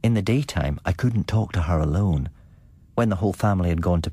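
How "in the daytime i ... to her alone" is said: ordinary delivery, nothing marked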